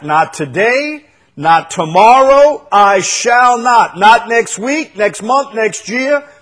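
rising proclamation; building preaching. Acted. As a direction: hammer each phrase, building up. Preacher building a bold confession.

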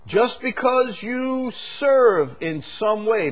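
high, loud, slow soaring proclamation; fervent sermon climax; preaching at full force. Acted. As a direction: drive it home to the congregation. Preaching crescendo.

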